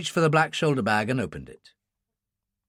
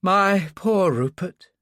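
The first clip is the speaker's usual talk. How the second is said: sorrowful; 6 semitones higher, 4 dB louder, much slower than usual. Acted. Grieving over the coffin.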